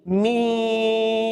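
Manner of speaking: मीम held very slowly and soft; long meem. Shown as a demonstration. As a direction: stretch मीम five alifs. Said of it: "मीम को हम पांच अलिफ खींचेंगे". (मीम को पांच अलिफ खींचकर)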